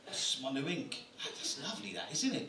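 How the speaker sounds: lightly voiced butterfly, quick and soft; a hint of a chatty butterfly. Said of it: "my impression of a butterfly".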